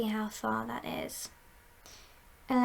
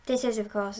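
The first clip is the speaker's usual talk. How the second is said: ordinary talk, nothing marked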